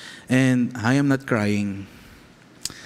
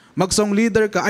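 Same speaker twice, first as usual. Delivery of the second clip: father's request; 8 semitones higher than usual. Retold or imitated. Father asks son to lead songs.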